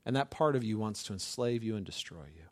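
ordinary talk

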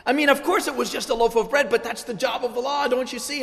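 high, loud, urgent pleading; leaning over the table, insisting he see it; retold frantic excitement. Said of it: "And I was going crazy".

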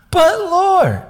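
high, loud mock whine; whiny protest. Gideon objecting to God's command, whiny mock protest. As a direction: plead in a put-on squeal. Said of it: "you gotta have a little bit of the, eh".

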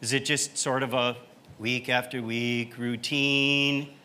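ordinary talk, nothing marked

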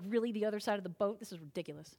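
disbelieving disciples scoffing. Retold or imitated. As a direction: mutter it like it's absurd.

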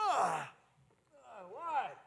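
groggy mumble that bursts into a high yelled "shut up"; sudden rebuke. Acted. Jesus woken, rebuking the storm.

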